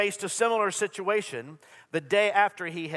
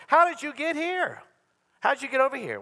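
high, astonished asking; a bewildered how did you get here; puzzled crowd question; 9 semitones higher than usual. Retold or imitated. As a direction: mimic the baffled crowd. Crowd asks Jesus how he came.

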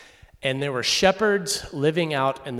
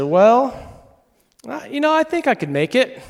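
much higher, louder mock-sweet mom voice; mom changing her mind; 12 semitones higher, 8 dB louder than usual. Mom on the phone deciding she can come after all, high and sweet. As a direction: play mom suddenly keen because of the shops.

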